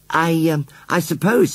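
a hint of a bashful boy, halting; shy boy John. A narrator's reading. John hesitantly voiced in story.

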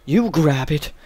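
imitating a weirdo character's husky line; kinky weird voice. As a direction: put on a creepy, breathy weirdo voice. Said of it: "Why does he sound like that?".

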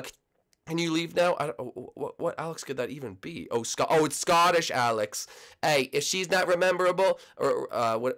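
ordinary talk, nothing marked

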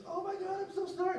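very high, panicked wailing apology; hammed-up tearful squeal; frantic mock crying. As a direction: imitate a hysterical aunt apologizing. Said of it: "frantically crying".

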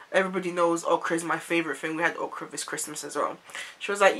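normal talking voice

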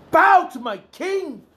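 bow to my king, cried out high and loud; high proclaiming cry. Real, not acted. Preacher crying out to the congregation, high loud command. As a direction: call it out high and slow, a command.

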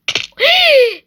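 sharp, high startled gasp; loud high gasp of surprise; shocked gasp. Real, not acted. Dies in game.

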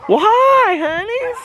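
very high, squealed hello to pets; high-pitched doggy greeting. Real, not acted. Vlogger greets little dogs outside, high doggy-talk.

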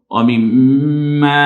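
মিম্মা slow and loud, humming mm then long aa; ghunna and stretch. Shown as a demonstration. Teacher models one-alif stretch, ghunna and stretch. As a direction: hold the m, then draw out the end of মিম্মা. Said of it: "এক আলিফ টেনে বলবো".

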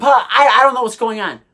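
usual speaking voice

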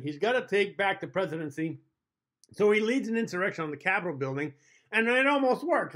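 usual speaking voice

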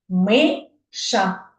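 "Миша" split into two beats; syllable by syllable. Shown as a demonstration. Teacher counting syllables with the children, syllable by syllable. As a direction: separate Ми and ша clearly. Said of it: "Давайте ще підкладаємо".

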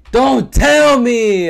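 slow, loud, high wail of "Don't tell me!"; despairing howl; anguished cry. Real, not acted. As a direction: drag out "Don't tell me!" in disbelief. Game crashes.

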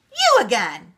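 cross little leprechaun; angry cry. A narrator's reading. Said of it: "stamping his feet angrily".

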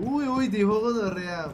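slow, loud mock scandal; mock scandalized. Real, not acted. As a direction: act shocked at the swear. Teasing scandalized reaction.

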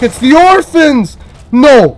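hammed-up dismay at the orphans; excited shout. Real, not acted. Orphans appear in game.

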